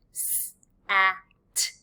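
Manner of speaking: "S, a, t" said as separate letter sounds; sounding out words. Shown as a demonstration. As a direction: sound out "S, a, t" one sound at a time. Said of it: "as we are sounding each individual, um, sound".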